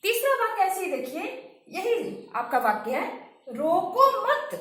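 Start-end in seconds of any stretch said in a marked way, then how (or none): 3.5-4.6 "रोको मत" joined, high and loud, rising on मत before a stop; pause after मत. Shown as a demonstration. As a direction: join "रोको मत" slowly, then halt after मत. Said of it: "तब विराम चिन्ह आपका लगा है". ("रोको मत" साथ में, ऊँचे स्वर में, फिर विराम)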